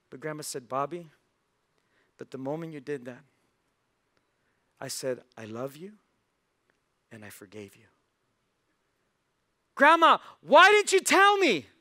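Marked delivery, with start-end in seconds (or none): 0.7-7.9 soft, lowered, with gentle pauses; tender forgiveness. Acted. Grandma forgiving her grandson, soft and tender. 9.7-11.7 very high, loud wail of disbelief; anguished protest. Acted. Boy learning grandma knew all along, high loud protest.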